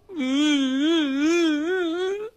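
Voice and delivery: high, long-drawn mock wail; hammed-up droning cry; bawling sob. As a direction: bawl wordlessly like a big overgrown child. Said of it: "兄弟，别哭".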